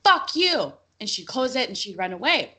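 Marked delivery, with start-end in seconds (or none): 0.0-0.7 put-on high, louder childish yell; little girl yelling. Little sister yelling at the guests at the door, high and loud. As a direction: shout it like a cheeky little kid. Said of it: "and she goes".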